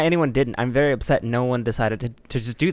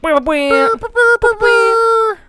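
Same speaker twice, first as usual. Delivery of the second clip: high wah sound effect; 7 dB louder, much slower than usual. Pretend.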